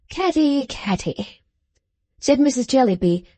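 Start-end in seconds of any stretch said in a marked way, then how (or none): 0.1-1.4 fading tired sigh of a name; weary. A narrator's reading. Weary mother brushes off her daughter's request. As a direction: let it sink away, worn out. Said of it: "quite weary of such little matters".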